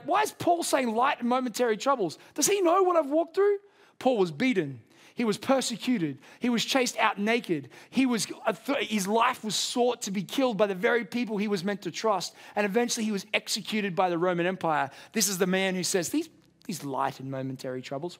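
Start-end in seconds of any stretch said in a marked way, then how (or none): 0.0-3.6 higher, indignant disbelief rising to a much higher pitch; incredulous objection. Pretend. Imagined hurting listener objecting to Paul, incredulous and indignant. 16.1-18.2 softer and lower, tender and hushed; tender quote. Acted. Preacher quoting Paul after listing his sufferings, soft and tender.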